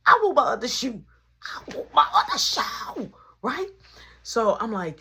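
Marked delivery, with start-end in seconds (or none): 0.1-1.0 loud, high demand; retold demand. Demanding his lost shoe back. 1.4-3.1 very high, mock-tantrum wail; retold wailing demand. Repeats the demand, more worked up.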